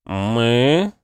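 «Мы» with a long, drawn-out «ы»; puzzled drawl. Acted. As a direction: stretch the «ы» in «Мы», puzzled. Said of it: "недоуменно растягивая «ы»".

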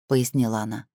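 ordinary delivery

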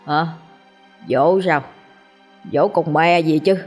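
put-on slurred angry drunk cursing; a drunk father scolding his kids; drunken rant. A narrator's reading. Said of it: "lè nhè chửi bới". (say xỉn chửi bới lè nhè)

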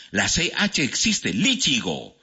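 ordinary talk

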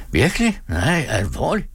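high, astonished question; surprised. Acted. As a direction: catch him off guard. Møller surprised by news.

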